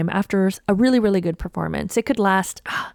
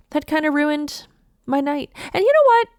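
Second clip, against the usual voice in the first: usual speaking voice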